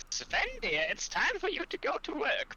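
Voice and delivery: put-on very high, sugary old-dear voice; high-pitched dainty character voice ordering sweetly; Fanny. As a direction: coo the orders in a high, airy falsetto. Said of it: "is gonna be like".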